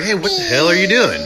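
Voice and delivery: high, loud mock outburst; indignant challenge. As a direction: imitate someone calling out in disbelief. Coworker's challenge acted out.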